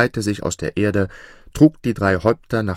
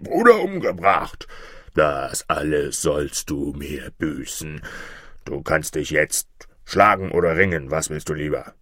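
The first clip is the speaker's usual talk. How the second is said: menacing dragon; 4 semitones lower than usual. A narrator's reading. Six-headed dragon accuses boy over slain brother, low menace. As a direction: accuse the trespasser as a looming dragon. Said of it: "Da brach plötzlich ein sechshäuptiger Drache aus dem Dickicht".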